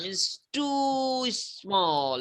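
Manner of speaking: TOO stretched very long and high; high, very slow "too small" to show tininess; drawn-out emphasis. Real, not acted. Teacher stresses how tiny it is.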